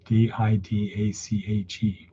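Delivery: ordinary delivery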